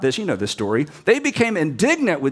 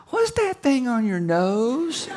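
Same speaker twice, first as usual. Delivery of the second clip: put-on child's voice; 8 semitones higher, much slower than usual. Child interrupting tender moment.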